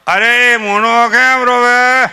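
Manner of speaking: hammed-up grandpa fussing over the baby; fussing grandfather. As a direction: grandfather calling out over the crying grandchild, loud and slow. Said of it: "સાથે એનો દાદો".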